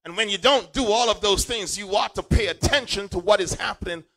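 high, loud, half-sung preacher cadence; preaching at full force. Acted. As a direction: whoop it up to the congregation.

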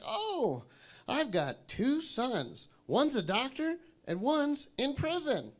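high, slow granny voice. Retold or imitated. Granny to the passenger beside her about her sons, high granny voice. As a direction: pipe up high as a chatty granny. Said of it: "So Granny pipes up next to me".

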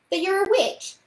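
put-on high, loud childish interjection; blurted interjection. A narrator's reading. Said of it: "put in Paul".

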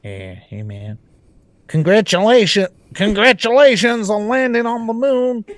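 American accent as a mock president on the phone; bad American accent. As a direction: put on a cheesy American accent for the call. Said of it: "what's my American accent?".

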